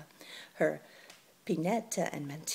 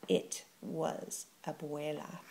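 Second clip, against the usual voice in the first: usual speaking voice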